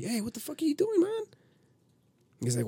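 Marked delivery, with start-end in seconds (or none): 0.0-1.2 high-pitched, indignant demand; retold outrage. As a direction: stop the recording and confront the rude guest, voice shooting up. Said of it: "I had to pause it and be like".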